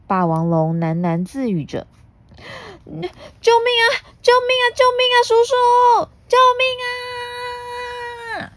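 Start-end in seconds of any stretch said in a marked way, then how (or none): 2.8-8.5 panicked cry for help. Acted. Puna trapped by the dragon, screaming help.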